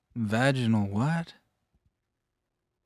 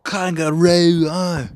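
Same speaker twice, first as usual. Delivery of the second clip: mock Aussie bloke; 4 semitones higher, 6 dB louder than usual. Mock Aussie tough talk.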